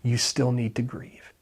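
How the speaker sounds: slow, soft whisper of conviction. Real, not acted. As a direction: whisper it tenderly to them. Sermon point dropped to whisper.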